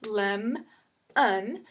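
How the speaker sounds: "lem" then "un" apart; split into syllables. Shown as a demonstration. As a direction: break it: "lem", pause, "un". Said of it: "consonant is with the beginning".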